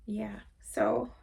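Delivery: breathy, choked whisper through tears; barely able to speak through tears; crying, whispered; tearful whisper. Real, not acted. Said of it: "I'm crying now".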